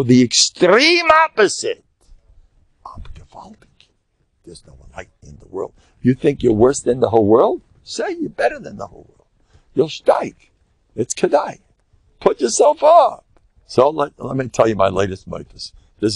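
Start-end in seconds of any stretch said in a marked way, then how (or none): 2.8-5.7 breathy whispered boast, much softer; mock whisper. 7.8-11.6 high urging. Acted. Urging self-praise. 12.2-13.2 slow, much higher rallying call; high emphatic urging. Acted. Urging self-praise.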